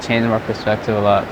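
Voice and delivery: ordinary talk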